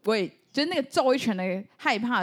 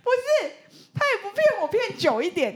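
indignant protest; 12 semitones higher, 3 dB louder than usual. Real, not acted. Protesting mother's short lie.

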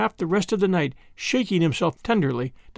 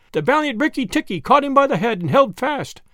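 much higher, a little louder retake; 7 semitones higher, 3 dB louder than usual. Shown as a demonstration. Narrator rereads the line raised high and a bit louder. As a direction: lift the whole line higher for a brighter take. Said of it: "repeated higher".

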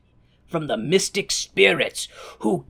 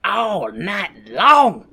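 very slow, sultry preacher drawl; hammed-up slow Lovelace; much slower than usual. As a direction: seduce the crowd like a love guru. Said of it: "Lovelace is like".

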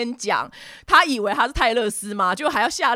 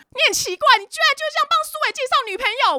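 very high, fast shrieking rant; mock furious tirade; 3 dB louder, faster than usual. Regina berates the friend for matchmaking Suwei, shrieking mock tirade. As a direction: imitate her screeching in outrage. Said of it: "继续破口大骂".

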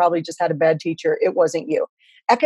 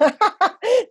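high laugh, caught off guard; bright burst of laughter. Real, not acted. Guest laughs at host.

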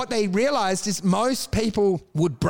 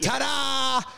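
higher-pitched magician's ta-da; showman's reveal; 7 semitones higher than usual. Acted. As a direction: present it like a stage trick's big reveal. Playing magician revealing a prank.

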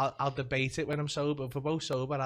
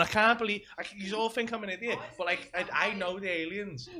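imitating her kicking off; 6 semitones higher, 4 dB louder than usual. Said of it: "she'll fucking kick off on me".

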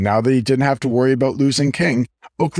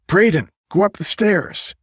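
higher-pitched call to a friend; 8 semitones higher than usual. A narrator's reading. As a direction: call out an order from a distance. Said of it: "he called".

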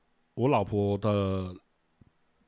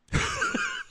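giggle. Real, not acted. Laughs at own innuendo.

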